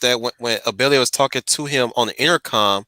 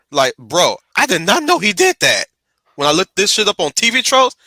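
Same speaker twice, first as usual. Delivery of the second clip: usual speaking voice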